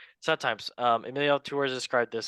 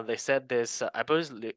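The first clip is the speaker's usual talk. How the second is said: normal talking voice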